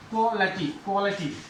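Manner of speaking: क्वालिटी as KWO-luh-tee; correct pronunciation. Shown as a demonstration. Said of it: "उसको अटी पढ़ते हैं".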